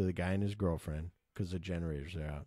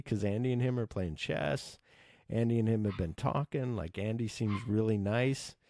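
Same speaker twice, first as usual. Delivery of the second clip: ordinary delivery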